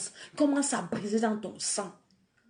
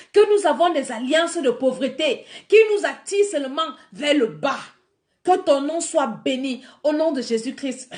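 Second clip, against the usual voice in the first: louder fervent preaching; 4 semitones higher, 5 dB louder than usual. Acted. Preacher pressing home the lesson to her flock, fervent. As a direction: preach it out, driving each phrase.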